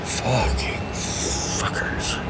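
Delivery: whispered curse. Acted. Frustrated curse under breath.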